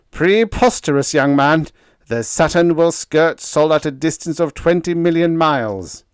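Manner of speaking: loud, high, scoffing outburst; scornful fury. A narrator's reading. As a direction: dismiss him angrily. Gilchrist scoffing in anger.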